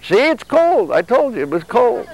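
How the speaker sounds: put-on high, childish I-told-you-so; lightly voiced grumpy body, smug and high-pitched; sulky body voice. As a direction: whine back like a stubborn kid who won't leave bed. Arguing with his own body.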